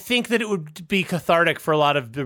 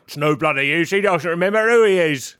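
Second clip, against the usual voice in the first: put-on British accent; hammed-up British superhero complaint; Iron Man. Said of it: "Iron Man's just like".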